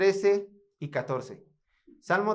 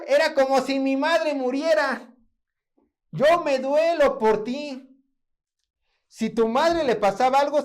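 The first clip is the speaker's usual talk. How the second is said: raised, louder impassioned voicing of David; fervent preaching; 8 semitones higher, 6 dB louder than usual. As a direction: preach it with full heart. Preaching David's words with fervour.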